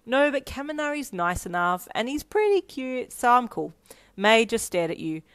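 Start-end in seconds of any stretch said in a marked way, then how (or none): 0.1-3.7 high, loud giddy defence. A narrator's reading. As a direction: insist brightly, high and loud. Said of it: "you cackled manically".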